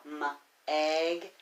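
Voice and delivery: M-egg split into m and egg; sounding out. Shown as a demonstration. Teacher sounding out Meg for pupils. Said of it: "word that we can tap out".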